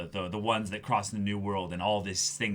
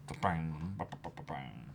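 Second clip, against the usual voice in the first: lower, softer bang noises dying away; fading gunfire; 5 semitones lower, 9 dB quieter than usual. Acted. Fan voicing the gunfire trailing off, softer. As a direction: let the shots fade out.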